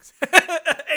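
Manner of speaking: bursting into a high laugh; real laugh. Real, not acted. Cracking up over John Malkovich holding the pig, real laugh. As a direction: laugh out loud, cracked up by the memory. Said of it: "I just fucking started laughing".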